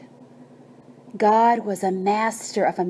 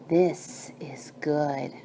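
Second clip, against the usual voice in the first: slow hushed whisper; whispered God; 4 semitones lower, 6 dB quieter, slower than usual. Acted. God admiring the birds, in a slow whisper. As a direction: breathe it out softly, a quiet blessing.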